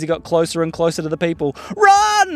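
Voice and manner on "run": high yell to flee; acted shout of alarm; panicked cry. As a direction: sound the alarm in mock panic. Customers flee the drills.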